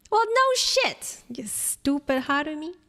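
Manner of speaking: high, drawn-out exasperated cry; indignant, high-pitched scolding; exasperated outburst. Real, not acted. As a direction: burst out high at the character, stretching the words. Snaps at a game character.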